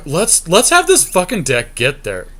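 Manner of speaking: ordinary talk, nothing marked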